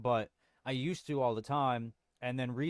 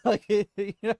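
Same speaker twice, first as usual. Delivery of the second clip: laughing; 9 semitones higher, 8 dB louder than usual. Real, not acted. Laughing at own joke.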